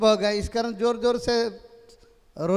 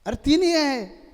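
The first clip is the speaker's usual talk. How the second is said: put-on dismayed drunk cry; 6 semitones higher, 4 dB louder than usual. Drunk finds only three again.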